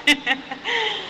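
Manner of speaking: quick amused chuckle; short laugh. Real, not acted. The preacher laughs at a lion paw image, short chuckle. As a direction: laugh lightly at the picture.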